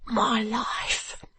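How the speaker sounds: unhappy stationer despairing before his wife; unhappy cry. Acted. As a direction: gasp it out in misery. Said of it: "says the unhappy stationer".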